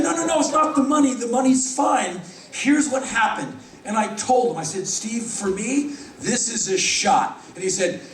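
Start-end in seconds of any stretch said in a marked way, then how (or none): 0.0-3.6 eager insistence. Retold or imitated. Eager insistent reply to boss. 4.9-7.4 put-on young worker earnestly tells his boss this is his chance; earnest plea.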